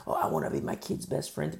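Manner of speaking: low, breathy mocking whine; mock parent whine. Preacher mimicking a permissive parent in a low mocking whisper. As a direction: sneer at the parent who wants to be a friend.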